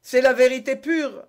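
high, loud protestation; exclaiming. A narrator's reading. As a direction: cry out that it is so. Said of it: "s'écria le mercier". (exclamation aiguë et forte)

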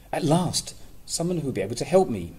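high, fast, loud relief; excited. Acted. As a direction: burst out with hopeful relief. Mole thinks, relieved.

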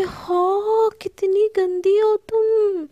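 high, slow mock-hurt whine; teasing. A narrator's reading. Palak teasing Sana for sending her home, high slow whine. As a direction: drag it out in playful offense.

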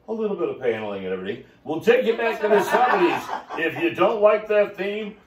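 upbeat pitchman selling limos to listeners; sales pitch. Acted. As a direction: hype it like a TV ad for the fleet. Pitches his limo fleet for sale.

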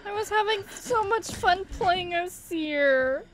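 high, whiny, crying complaint; tearful lament. Real, not acted. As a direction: wail it through rising tears. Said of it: "I'm fucking crying".

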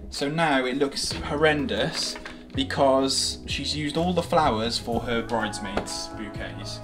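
normal talking voice

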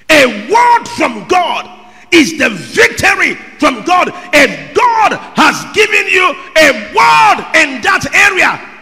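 loud, high, drawn-out preacher's shout; fiery sermon climax; preaching at full force. Real, not acted. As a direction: thunder it over the congregation.

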